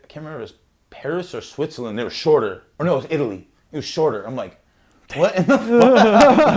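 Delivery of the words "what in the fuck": much higher and louder, slow, laughing; mock disbelief. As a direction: stretch out the outraged question through a laugh. Shocked at short European toilets.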